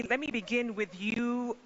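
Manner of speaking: normal talking voice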